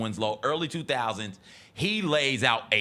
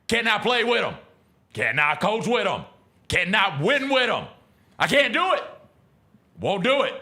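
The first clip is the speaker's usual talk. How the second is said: louder and higher, each line punched like a furious coach; mock coach rant; 7 semitones higher, 4 dB louder than usual. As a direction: rant it like a fed-up coach at the podium. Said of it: "this is one I quote from".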